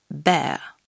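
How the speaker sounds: "Bear" with an open e and a silent r; English vowel in "Bear", r dropped. Shown as a demonstration. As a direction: say "Bear" like a French e, no r. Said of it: "qui se prononce comme un E français".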